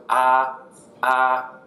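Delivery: AH meant to go up in pitch; rising tone. Shown as a demonstration. Teacher showing second tone, rising AH. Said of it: "Second one goes up".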